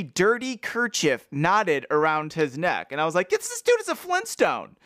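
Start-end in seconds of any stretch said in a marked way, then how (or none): 3.3-4.8 high, incredulous exclamation; mock amazement. Reader reacting to a caveman-like man, high mock amazement. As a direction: squeak it in comic disbelief.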